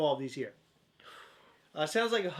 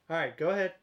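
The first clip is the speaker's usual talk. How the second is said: ordinary delivery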